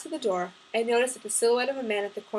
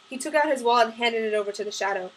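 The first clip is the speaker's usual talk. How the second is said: plain reading voice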